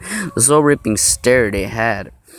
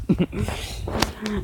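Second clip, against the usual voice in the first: high giggle; 10 semitones higher, 9 dB quieter than usual. Acted. Laugh after strangling joke.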